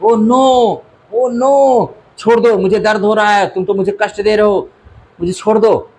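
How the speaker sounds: high, pleading cry to let go; a child crying out in pain, high and urgent; little Golu begging the crocodile to let go, high pained cries. Acted. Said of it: "Golu लगा चिल्लाने".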